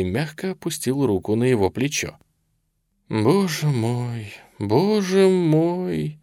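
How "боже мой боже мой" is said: slow, drawn-out rueful exclamation; remorseful. A narrator's reading. Colonel feigning regret to his bound prisoner, slow and remorseful. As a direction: lament it with rising pitch, stretching the vowels. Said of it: "с каким-то раскаянием в голосе".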